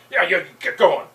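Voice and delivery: loud, impatient yeah yeah, pushing him out; a hammed-up gunman shooing him along. Gunman shoving the narrator toward the alley, loud and impatient.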